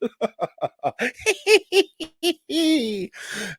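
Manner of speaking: a long, high giggling laugh that falls away; high cackling laugh. Real, not acted. Reviewer laughing at his own late-night drinking, high giggle.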